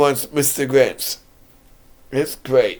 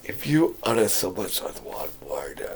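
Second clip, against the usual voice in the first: hushed menacing whisper as the Grinch; a threatening whisper that leans in close; 10 semitones lower, 12 dB quieter than usual. Retold or imitated. Vlogger retelling the Grinch's threat to his uncle, in a whisper. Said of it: "He goes".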